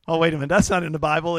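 ordinary talk, nothing marked

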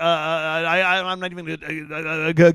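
halting, repeated syllables; stuttering. Acted. Moses protesting to God that he can't, stuttering. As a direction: trip and stick on the words. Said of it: "I stutter".

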